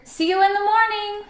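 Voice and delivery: high, sustained farewell call. A narrator's reading. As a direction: call after a departing friend. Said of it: "called Stuart".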